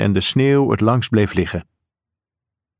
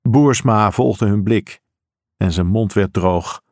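usual speaking voice

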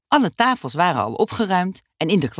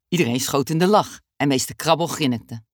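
ordinary delivery, nothing marked

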